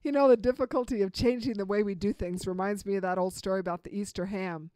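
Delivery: normal talking voice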